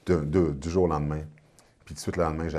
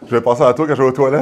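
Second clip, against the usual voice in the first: loud joking; 8 semitones higher, 15 dB louder than usual. Real, not acted. Joking threat after spicy bite.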